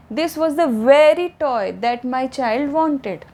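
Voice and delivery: this was the very toy, very toy said slowly with weight; stress on very toy. Shown as a demonstration. Teacher showing stress on toy. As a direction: lean on very toy. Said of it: "लेकिन stress दिया है टॉय noun पे".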